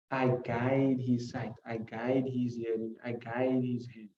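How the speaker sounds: each phrase laid down slowly and evenly; slow, deliberate. Shown as a demonstration. Teacher pacing out each line so students catch it.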